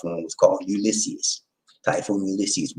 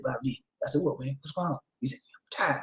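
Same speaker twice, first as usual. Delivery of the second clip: quiet retold quote; 4 semitones higher, 11 dB quieter than usual. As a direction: mutter it wearily.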